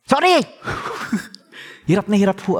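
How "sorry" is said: strangled high shouted sorry, forced out; mock demon-possessed yell. A proud father choking on an apology to his wife, shrieking. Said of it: "Para ho akong nadidemon".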